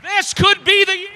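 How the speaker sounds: high call fading into the noise; preacher calling out; preached over the crowd. Acted. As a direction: cry it out then drop away.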